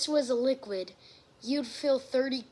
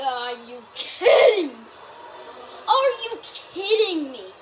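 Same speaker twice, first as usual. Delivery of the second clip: pubescent voice crack; 4 dB louder than usual. Real, not acted. Player blaming puberty as he protests, cracking voice. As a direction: exclaim it and let the voice crack. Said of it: "hitting puberty right now".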